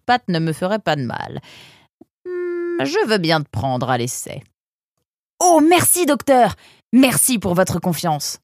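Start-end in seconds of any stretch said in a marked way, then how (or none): 5.4-8.4 joyful cry. A narrator's reading. Apprentice thanking the doctor for hiring him, joyful cry. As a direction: burst out in grateful joy. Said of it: "cria Mazen".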